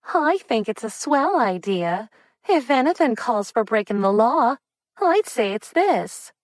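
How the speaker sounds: bubbly flapper cheering the idea; flirty eager. A narrator's reading. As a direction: egg Raymond on. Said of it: "Lottie countered".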